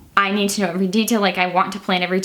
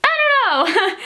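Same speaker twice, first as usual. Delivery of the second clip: very high, comic reluctance; hesitant doubter; 3 dB louder, slower than usual. Acted. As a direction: squeak out the doubt, nearly laughing. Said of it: "And he was like".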